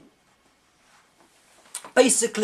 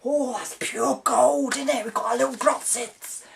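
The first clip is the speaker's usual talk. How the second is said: cute greedy bandits; 6 semitones higher than usual. Retold or imitated. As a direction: coo over the gold like a greedy little bandit. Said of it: "it's just like".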